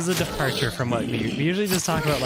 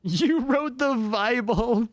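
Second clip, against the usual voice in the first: slow mock shout; 10 semitones higher, 5 dB louder, much slower than usual. Mock accusation.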